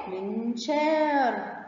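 "منشار" very slow, aa stretched out; long aa. Shown as a demonstration. Teacher sounding out a word for pupils, "منشار" with long aa. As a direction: draw out the "شا" inside "منشار". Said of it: "مد بالألف".

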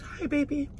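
high, soft, tender mimicry; imitating sweet sister. Sister greeting her on waking, imitated sweet and high. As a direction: coo it gently in a lifted voice. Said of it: "She's always so sweet".

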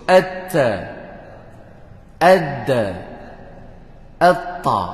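At, ad, atta dengan huruf mati; drill with alif. Shown as a demonstration. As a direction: latih atta perlahan. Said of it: "Nah, kita sebut". (latihan atta)

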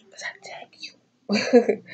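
slow teasing whisper; slow conspiratorial whisper, tagging the viewers; playful whisper. Real, not acted. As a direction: whisper it to the camera like a secret dare.

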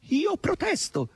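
very high, slow squeak; squeaky slow protest; protesting boar. A narrator's reading.

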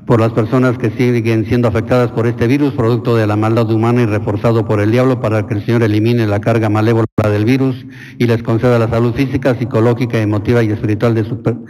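steady liturgical prayer voice; intercession prayer. Acted. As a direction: lead the congregation in petitions. Leads prayers of the faithful.

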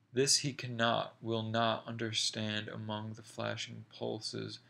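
usual speaking voice

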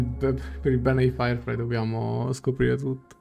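ordinary talk

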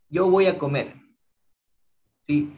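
usual speaking voice

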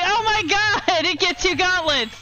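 high, slow, stunned delight; amazed discovery. Retold or imitated. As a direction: stretch out the disbelief. Retelling discovering the glitch.

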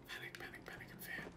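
hushed mock-panic whisper. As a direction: breathe it as a secret.

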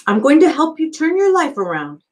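a hint of an eager, upbeat counselor; cheery counselor. A narrator's reading. Counselor greeting the new boy, lightly upbeat. Pendanski voiced.

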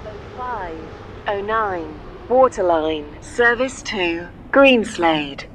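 normal talking voice

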